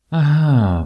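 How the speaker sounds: a loud, high "آها" as it all clicks; sharp realization. A narrator's reading. Wife realizing husband's scheme, loud sharp aha. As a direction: catch him out with a sudden loud aha. Sudden realization of his motive.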